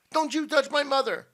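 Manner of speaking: put-on loud, high, angry boy; protective yell. A narrator's reading. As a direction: yell to defend your mother. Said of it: "yelled Tom".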